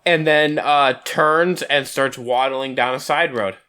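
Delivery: ordinary delivery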